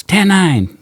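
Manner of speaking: sudden high loud radio call. Retold or imitated. A cop blurting a code on the radio, loud and high. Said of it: "And somebody goes".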